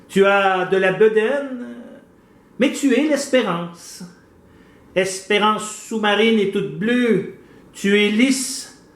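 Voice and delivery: slow, declaimed verse with pauses between phrases; poem recitation. Acted. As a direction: recite it line by line, unhurried and deliberate. (déclamation lente d'un poème)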